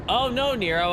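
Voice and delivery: ordinary talk, nothing marked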